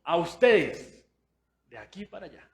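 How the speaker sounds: hammed-up preacher, dragging the words; mock preacher. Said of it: "el pastor acá y". (imitación de pastor, lenta y exagerada)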